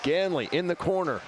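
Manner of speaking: live hockey play-by-play. Acted. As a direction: call the action live. Play-by-play of game.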